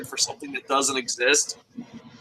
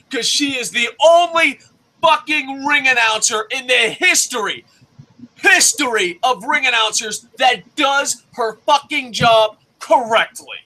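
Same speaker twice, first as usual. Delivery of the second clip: heated shouting rant; 6 semitones higher than usual. Real, not acted. Ranting at doubting viewers about ring announcers, shouting. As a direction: shout it like you're fed up.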